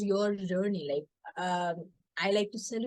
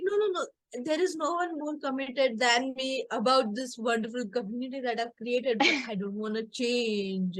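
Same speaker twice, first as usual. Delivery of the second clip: mock indignant protest; 4 semitones higher than usual. Daughter insists to mom she is committed, mock indignant. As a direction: act out a heated comeback, easing down.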